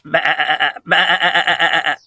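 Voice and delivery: quavering sheep baas; broken-up, juddering baa; bleating sheep. Acted. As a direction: bleat in a rapid wobble like a sheep. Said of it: "said the sheep".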